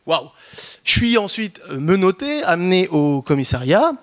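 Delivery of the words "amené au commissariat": normal talking voice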